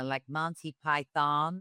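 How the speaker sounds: drawn-out American "Monty Python"; mock an American fan slowly savouring "Monty Python"; American accent. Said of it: "You meet Americans, even now, they're like".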